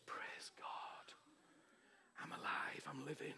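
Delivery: faint whisper of thanks; weak whisper just after waking; whispered praise. Retold or imitated. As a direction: breathe the words barely voiced, waking up. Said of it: "first thing on my lips was".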